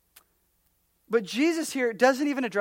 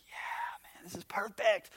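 hushed, delighted whisper over the food; 9 dB quieter, slower than usual. Pretend. Diner whispering over his fish and chips. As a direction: breathe it like a secret treat.

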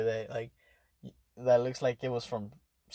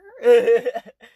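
big loud laugh, pitched up; loud burst of laughter; 10 semitones higher, 12 dB louder than usual. Real, not acted. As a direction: crack up at himself. Laughs at own correction.

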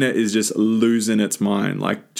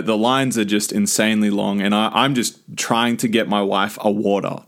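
ordinary talk, nothing marked

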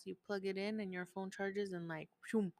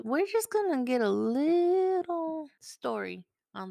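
higher, louder playful tease; excited build-up; 7 semitones higher, 10 dB louder than usual. Real, not acted. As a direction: hype it up. Excitedly calls son.

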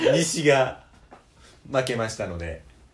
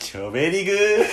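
retro gyaru catchphrase, laughing; gyaru speak; 7 semitones higher, 6 dB louder than usual. Pretend. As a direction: toss out 90s gyaru slang. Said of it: "なんでずっと昔なの？".

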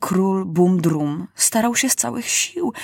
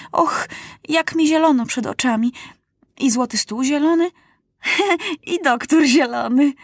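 dazed wonder; 7 semitones higher than usual. A narrator's reading. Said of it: "krzyknął Maciuś".

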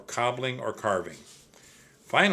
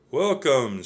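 thin shaky voice; 4 semitones higher, 7 dB louder than usual. A narrator's reading. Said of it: "in a thin, shaky voice".

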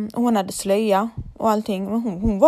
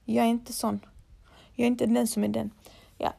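normal talking voice

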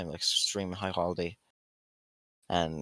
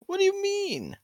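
indignant; 4 dB louder than usual. Acted. Mock outrage at insult.

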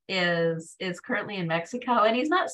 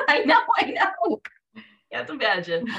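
laughing; 6 semitones higher, 5 dB louder than usual. Real, not acted. Laughing agreement after story.

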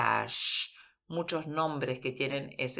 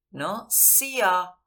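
ordinary talk

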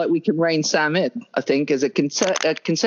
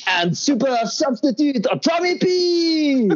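hyped shout; 9 semitones higher, slower than usual. Pretend. Hyped team intro.

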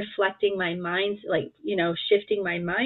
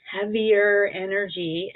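ordinary delivery

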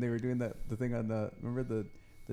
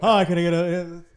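louder, higher, bright sales-pitch voice; salesman ordering voice; 5 semitones higher, 12 dB louder than usual. Retold or imitated. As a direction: put on the eager salesman at the drive-thru. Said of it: "He was totally".

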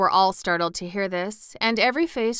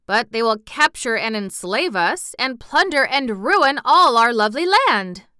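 building to a high, loud protest; increasingly alarmed, emphatic outcry; disturbed exclamation; 5 semitones higher, 9 dB louder than usual. A narrator's reading. Wizard protesting that the land will be ruined, disturbed. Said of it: "exclaimed the wizard, greatly disturbed".

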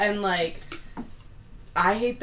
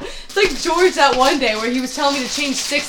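ordinary talk

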